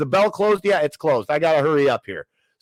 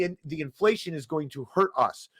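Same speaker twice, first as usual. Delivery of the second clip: usual speaking voice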